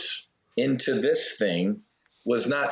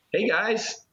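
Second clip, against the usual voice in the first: casual greeting; 10 semitones higher than usual. Retold or imitated. Waking up, friends crying.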